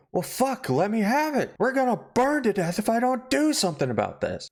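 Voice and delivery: high, pushy husband demanding the poker. Retold or imitated. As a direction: squeak it out like a panicky husband.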